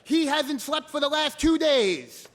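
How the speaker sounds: high, loud imitation of a screaming stepmother; retold screaming. Stepmother on the phone blaming him, high screaming scold. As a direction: scold at full pitch, imitating her. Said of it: "and she's screaming at me".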